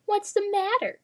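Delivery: taunting whispered sneer, pitched high; high bully whisper. Acted. Bully taunting the little boy, high mocking whisper.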